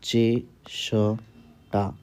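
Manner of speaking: चेष्टा stretched very slowly; slow dictation. Shown as a demonstration. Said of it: "अगला शब्द है चेष्टा".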